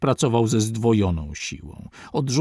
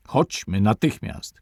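ordinary delivery

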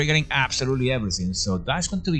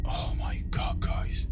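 scared, softer whisper; 7 dB quieter, slower than usual. Real, not acted. Gamer to his viewers in the scary house, a frightened whisper. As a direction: breathe it out so the ghost won't hear.

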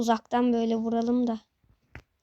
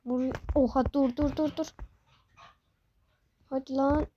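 normal talking voice

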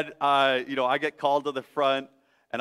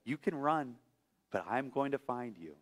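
quiet pursuing love; 10 dB quieter than usual. Retold or imitated. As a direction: promise it softly, like a mother who won't let go. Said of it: "the kind of love that says".

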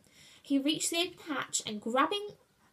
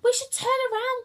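high, loud, hurried squeal; 6 dB louder, faster than usual. A narrator's reading. Frightened Cornelius urging turning back, squealed. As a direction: squeal it in alarm. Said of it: "Cornelius squealed".

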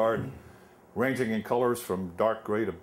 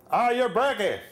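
loud, much higher Pennsylvania Dutch bark; Pennsylvania Dutch shout; 13 semitones higher, 6 dB louder than usual. Retold or imitated. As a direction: shout it at the new guy in a thick Pennsylvania Dutch accent. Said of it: "they talk funny and they shout".

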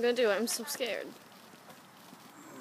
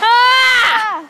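startled scream; 10 semitones higher, 9 dB louder than usual. Real, not acted. Firework bursts.

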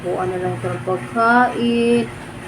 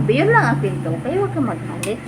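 normal talking voice

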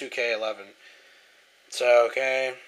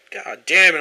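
loud angry swear; real angry curse; 3 semitones higher, 8 dB louder than usual. Real, not acted. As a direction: spit it out in anger. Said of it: "I'm fucking pissed".

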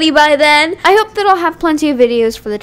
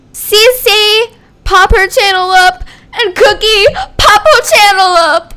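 higher, louder shout-outs; loud tribute; 6 semitones higher, 6 dB louder, slower than usual. Real, not acted. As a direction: call the names out proudly. Tribute to members.

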